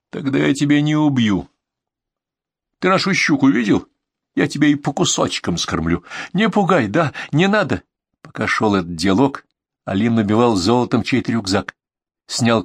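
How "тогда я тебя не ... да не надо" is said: high, agitated menace; heated threat. A narrator's reading. Bandit in a standoff trades threats, high and heated. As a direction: keep the pitch up, warning him off.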